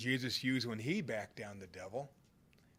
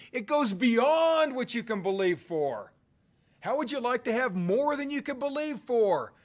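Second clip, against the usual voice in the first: louder, higher preacher's push, building up; preaching at full force; 8 semitones higher, 4 dB louder than usual. Acted. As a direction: rouse the congregation, driving every line.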